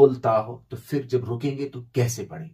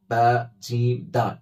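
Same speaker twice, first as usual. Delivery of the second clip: usual speaking voice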